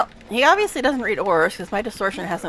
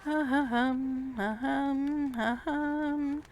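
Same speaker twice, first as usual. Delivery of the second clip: throat clearing; 3 semitones higher, 10 dB quieter, much slower than usual. Real, not acted.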